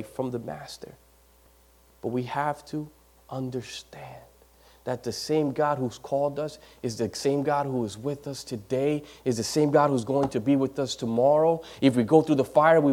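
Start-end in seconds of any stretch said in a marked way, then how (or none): 0.0-7.0 hushed, breathy single word; whispered pulpit emphasis. Acted. Preacher drops to a whisper.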